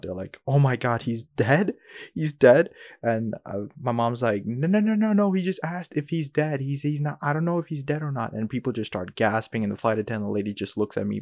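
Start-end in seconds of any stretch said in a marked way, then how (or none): 0.5-2.7 louder, rising shocked questions; mock freak-out. Passengers reacting to a feared death, imitated freak-out. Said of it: "fucking freak out". 4.5-8.3 high rapid-fire denial, easing down; hurriedly quash a rumour, acted out; imitated frantic correction. Mom correcting the panicking passengers, imitated frantic denial.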